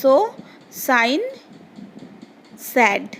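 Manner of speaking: सो, साइन, सैड each begun with hissing S; S sound demonstration. Shown as a demonstration. Teacher reading for pupils to copy, clear S in सो, साइन, सैड. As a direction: model a plain S on सो, साइन, सैड, word by word. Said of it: "और एक बार पढ़ रही हूँ".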